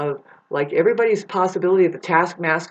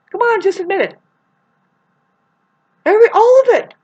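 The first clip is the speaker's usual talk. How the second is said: high excited urging; 8 dB louder, faster than usual. Real, not acted. Urging women to admit it.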